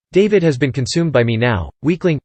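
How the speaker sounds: plain reading voice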